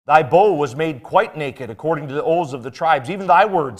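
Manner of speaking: fervent King James reading; scripture reading. Acted. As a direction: proclaim the verses with mounting force. Reads scripture in preaching voice.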